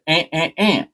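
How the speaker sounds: high imitated crow calls; crow squawk. Crow answering its owner, imitated squawks. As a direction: squawk three short notes like a crow. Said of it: "And she said".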